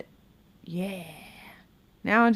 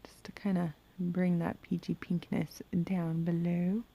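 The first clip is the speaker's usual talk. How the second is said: whispered while concentrating; soft whispered commentary; 7 dB quieter than usual. Real, not acted. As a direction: whisper the explanation as you work. Murmuring while applying liner.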